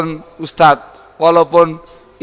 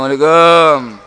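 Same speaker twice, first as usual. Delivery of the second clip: tired greeting; 5 dB louder than usual. Retold or imitated. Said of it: "Kata-kata orang capek".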